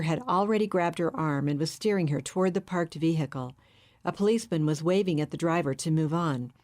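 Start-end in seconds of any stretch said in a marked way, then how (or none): none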